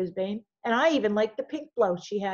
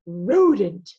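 rodent said louder, spat out with distaste; rodent with disgust; 8 dB louder than usual. Acted. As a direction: sneer the word rodent. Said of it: "Nobody says rodent quite the way".

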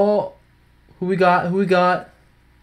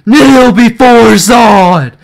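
slow loud commanding villain; dark lord; 8 semitones higher, 8 dB louder, much slower than usual. Pretend. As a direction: boom it out like a conquering tyrant. Quoting General Zod.

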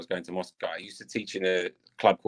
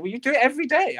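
very high, louder comic protest; mock protest; 6 dB louder, faster than usual. Retelling his comeback to the prankster, high-pitched mock protest. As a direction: squeal back in playful self-defence.

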